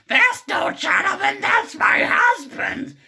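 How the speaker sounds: loud, very high cartoon wife screech; Marge Simpson. Marge correcting the man about her husband, loud falsetto screech. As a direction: shriek it in a put-on falsetto. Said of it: "the point where she says".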